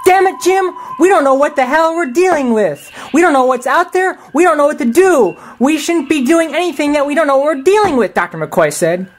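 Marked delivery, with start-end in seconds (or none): 0.0-8.1 furious rant. Acted. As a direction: blow up at the captain in alarm. McCoy protests going to planet.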